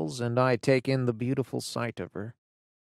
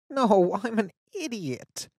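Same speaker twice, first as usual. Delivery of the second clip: higher, louder start, pushing the point on idiot; insist, more flustered now; insistent embarrassed girl; 10 semitones higher, 3 dB louder than usual. Acted. Fiona insists.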